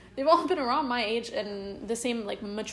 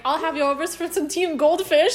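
mock posh British; 5 semitones higher, 7 dB louder than usual. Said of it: "you were gonna be like".

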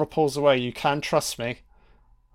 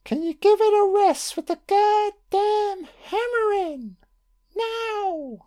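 much higher, drawn-out exasperated shout ending in a high snap; Bill Murray's furious yell; slower than usual. Retold or imitated. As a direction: quote the exasperated boss who's had enough. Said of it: "To quote, uh, Bill Murray from Scrooge".

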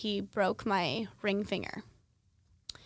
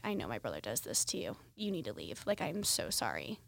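quiet sister; 10 semitones lower, 7 dB quieter than usual. Retold or imitated. Sister comforts her on the bed.

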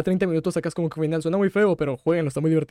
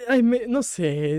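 teary; 7 semitones higher, slower than usual. Real, not acted. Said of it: "lloré demasiado".

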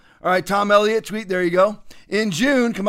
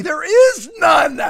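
slow, loud, higher insistence; emphatic shout; 8 semitones higher, 4 dB louder, much slower than usual. Real, not acted. Host rejecting electoral integrity, slow loud shout. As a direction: drive it home hard.

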